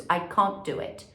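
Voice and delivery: British can't with ah vowel, small mouth opening; British accent. Shown as a demonstration. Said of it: "inglês mais britânico".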